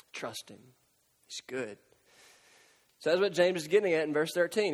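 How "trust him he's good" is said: much lower and softer, gentle and sincere; soft reassurance. Acted. Preacher comforting the congregation, hushed and reassuring. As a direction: reassure them quietly.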